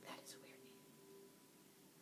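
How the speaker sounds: slow, hushed whisper; whispered aside. Retold or imitated. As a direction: whisper it like a private reaction. Retold private reaction, whispered.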